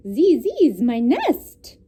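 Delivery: put-on loud, slow French-accented duck. A narrator's reading. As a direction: insist in a mock French accent. Duck claims the nest.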